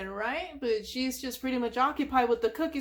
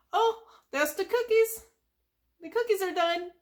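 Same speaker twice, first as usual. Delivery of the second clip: higher, bright little exclamation; excited Sandy; 8 semitones higher, 3 dB louder than usual. Retold or imitated. Sandy hearing her cookies are ready, pitched up with excitement. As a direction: perk up at the sound of the timer.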